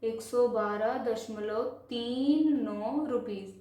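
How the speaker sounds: slow corrective reading, "तीन" and "नौ" apart; measured teacher's model of the number; digit by digit. Shown as a demonstration. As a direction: stretch "तीन", then a separate "नौ". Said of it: "कैसे पढ़ेंगे".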